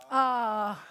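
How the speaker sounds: a long, loud, open "Ah" held out; open ah vowel. Shown as a demonstration. Teacher drilling the class on the Hebrew vowel, loud open "Ah". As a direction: call out "Ah" clearly for the drill. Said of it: "quiz, go".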